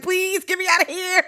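high-pitched hammed-up begging; mock pleading. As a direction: imitate a kid desperate to escape. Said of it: "you're just like".

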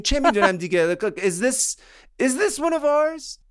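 high-pitched, chatty phone-call voice; general on the phone. Retold or imitated. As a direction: play a high-voiced caller making small talk, then asking a favour. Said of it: "He's like".

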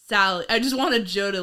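ordinary talk, nothing marked

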